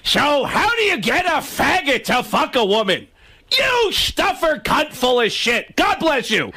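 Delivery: high, squawky stand-up impression; Gilbert Gottfried. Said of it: "Do it in the Gilbert voice".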